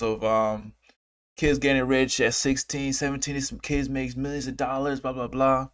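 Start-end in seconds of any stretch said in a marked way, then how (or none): none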